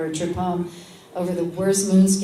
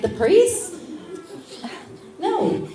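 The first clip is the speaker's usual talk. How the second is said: aghast; 12 semitones higher, much slower than usual. Retold or imitated. Villagers aghast at priest marriage.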